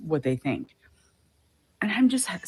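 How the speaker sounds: ordinary talk, nothing marked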